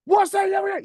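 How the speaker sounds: high mock yell at me; retold shout. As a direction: yell it like the angry teacher did. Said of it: "He was just shouting everything".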